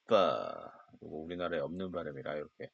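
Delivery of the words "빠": 빠 said as an English f, upper teeth on lower lip; f sound demo. Shown as a demonstration. Teacher showing students the f sound, biting lip on 빠. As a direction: bite the lip and blow out 빠 like f. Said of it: "빠 발음이라 했죠".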